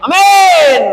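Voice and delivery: very high, loud blurted amin; jarring shouted amin. Retold or imitated. Preacher mocking a congregant's clashing loud amin. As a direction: bark out the amin off-key. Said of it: "nggak seirama gitu".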